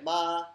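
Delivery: "Ma" steady on a high pitch; level first tone. Shown as a demonstration. Demonstrating the first tone again, high and level. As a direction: keep "Ma" high and flat. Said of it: "it's level, right? But it's higher pitch".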